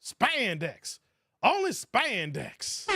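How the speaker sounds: lightly imitating a Nutty Professor character, words stretched; a slow, drawn-out movie quote; Eddie Murphy impression. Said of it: "remember Eddie Murphy and, uh, Nutty Professor?".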